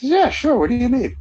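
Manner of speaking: high, loud imitation of a friendly yes; quoting Vin Scully. [name] quoting Vin Scully agreeing to reread liners, high and loud. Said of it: "he says".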